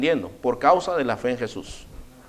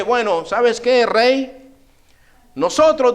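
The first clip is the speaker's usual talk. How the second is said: higher, proud defiant reply; chest out, defiant; 7 semitones higher, 4 dB louder, slower than usual. Retold or imitated. Three young men answering the king, proud and defiant. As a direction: stand your ground with your chest out. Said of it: "con el pecho salido".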